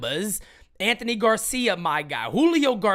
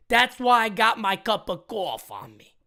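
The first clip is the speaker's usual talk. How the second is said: ordinary talk, nothing marked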